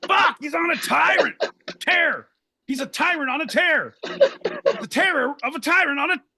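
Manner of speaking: high-pitched mock alarm; mock confused callers. Callers scared off by Pete's ire, high mock panic. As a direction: imitate panicked listeners, voice pushed way up. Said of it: "They're like".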